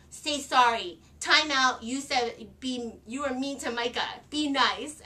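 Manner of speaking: lightly voiced little girl lecturing; a hint of a toddler playing teacher; bossy child. Daughter lectures mom, voiced.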